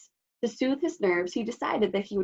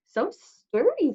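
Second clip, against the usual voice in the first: Thugmund's glowing voice; 8 semitones higher, slower than usual. Acted. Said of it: "Thugmund glowed".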